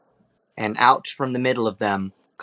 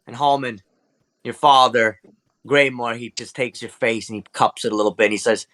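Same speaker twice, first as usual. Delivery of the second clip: ordinary delivery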